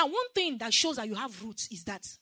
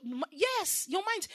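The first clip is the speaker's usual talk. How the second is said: ordinary talk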